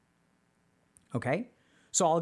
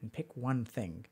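guided meditation; 5 semitones lower, 6 dB quieter than usual. Acted. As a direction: lead them gently through memory. Leads a meditation.

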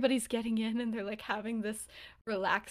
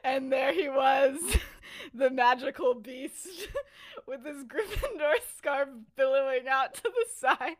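laughing while telling; 5 semitones higher, 4 dB louder than usual. Real, not acted. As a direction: barely get it out through the giggles. Teller cracking up at her twist.

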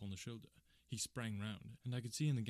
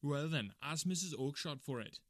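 raised, irate salesman voice; exasperated brush-off; angry retort; 4 semitones higher, 4 dB louder than usual. Acted. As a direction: retort impatiently, loud at the start.

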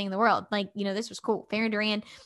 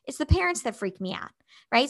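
ordinary talk, nothing marked

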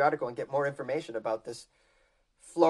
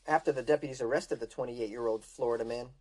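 normal talking voice

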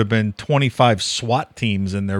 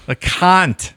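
higher-pitched Australian accent; Australian pronunciation; 5 semitones higher than usual. Shown as a demonstration. Cohost asked how Australians say it, Australian accent. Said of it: "how do they say it".

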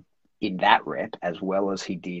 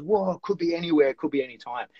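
higher-pitched mock wonder; lightly voiced amazed dog; 8 semitones higher than usual. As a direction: play a dog having a small revelation. Voices the amazed dog's reaction.

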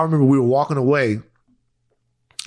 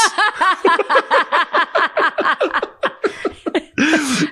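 long high burst of laughter; laughing hard at his own story. Real, not acted.

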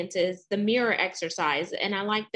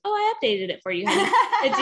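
teasing; 11 semitones higher, 7 dB louder than usual. Retold or imitated. Teasing husband in retold story.

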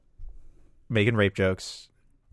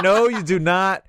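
mock panicked refusal; 7 semitones higher, 3 dB louder than usual. Comic begs host to drop it, mock panic. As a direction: retell a desperate no, pitched up.

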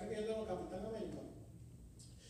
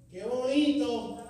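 sarcastic; 3 semitones higher, 9 dB louder, much slower than usual. Shown as a demonstration. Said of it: "Pues, qué bonito".

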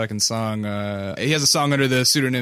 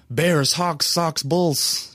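put-on Chicago accent; 6 semitones higher, much slower than usual. Teasing a Chicago native about her lost accent, mock Chicago accent. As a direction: ham up a thick Chicago sports-fan accent. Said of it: "'cause you're definitely not like".

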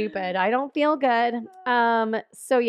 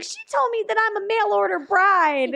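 high, wailing mock complaint; hammed-up tattling whine, pitched way up; whiny tattletale; 10 semitones higher, 3 dB louder than usual. New wife complaining to the dad about his daughter, mock whine.

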